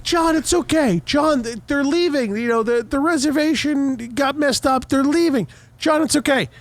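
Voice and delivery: high-pitched placating voice; mock soothing woman at the window; imitating placating lady. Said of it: "sticking up for us".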